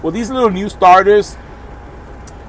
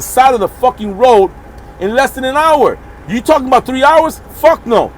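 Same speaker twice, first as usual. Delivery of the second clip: indignant rant; 7 semitones higher than usual. Real, not acted. Ranting about the repair bill.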